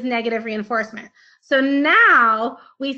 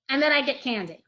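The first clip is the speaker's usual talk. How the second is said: usual speaking voice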